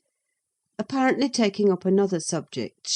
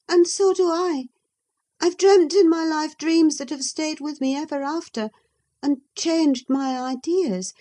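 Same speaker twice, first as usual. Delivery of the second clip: put-on higher, lively young woman's voice; a young lady answering back, pitched high; Catherine; 8 semitones higher than usual. Catherine's voice in the reading.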